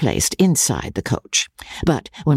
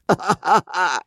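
put-on high rough laugh of an old lord; coarse cackle; 6 semitones higher than usual. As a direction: cackle after the boast. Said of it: "said Sir Pitt".

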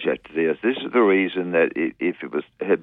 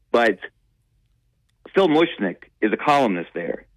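ordinary talk, nothing marked

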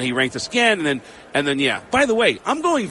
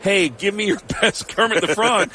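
higher, laughing taunt; jeering higher-pitched demand, breaking into a laugh; mock heckler; 5 semitones higher than usual. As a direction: exaggerate a pushy heckler's demand. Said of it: "cutting out the first part of it and saying".

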